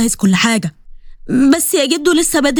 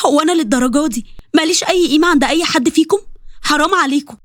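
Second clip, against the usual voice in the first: higher, hurt and bitter; a hurt girl accusing her family; bitter accusation; 4 semitones higher than usual. Acted. Sally accuses family of pity marriage. (اتهام مرير بنبرة مجروحة وعالية)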